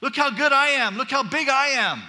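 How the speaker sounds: loud, high-pitched mock bragging; mock boasting. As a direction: crow it like a show-off. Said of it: "so unlike Paul to talk this way".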